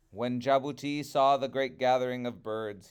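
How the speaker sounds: ordinary delivery, nothing marked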